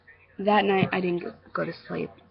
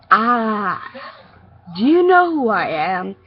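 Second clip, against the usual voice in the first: loud, challenging bully voice; cocky bully; 2 semitones higher, 8 dB louder, slower than usual. Acted. Bully confronts a kid in the street, cocky challenge. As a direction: challenge them loudly, pitch swooping down at the end.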